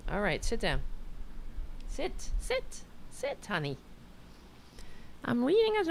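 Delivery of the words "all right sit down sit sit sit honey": dog talk. Real, not acted. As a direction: coax the dog into sitting. Telling the dog to sit.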